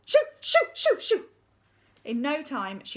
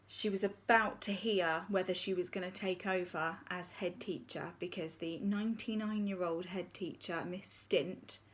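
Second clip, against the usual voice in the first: ordinary delivery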